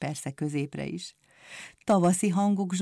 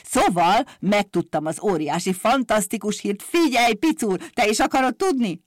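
loud, hyped-up crier with high opening cry; booming, excited town-crier patter; blaring fairground barker; 4 semitones higher, 7 dB louder than usual. A narrator's reading. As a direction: trumpet sensational news like a market barker. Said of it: "harsányon harsogott, mint egy vásári kikiáltó".

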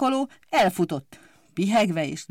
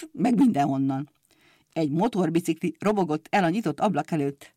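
usual speaking voice

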